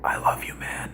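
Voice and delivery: soft breathy whisper into his ear. Retold or imitated. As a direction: hush it like a secret. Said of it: "he whispers".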